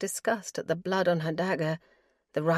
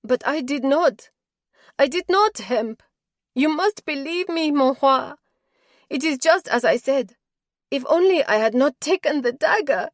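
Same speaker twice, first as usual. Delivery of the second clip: higher and much louder, pleading protest; bursting out; 8 semitones higher, 8 dB louder than usual. Acted. Doro protesting her innocence to Hemp, loud and desperate. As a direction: burst out against the injustice, begging him. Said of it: "The injustice of it burst out".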